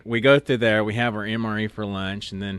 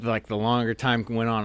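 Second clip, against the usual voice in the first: usual speaking voice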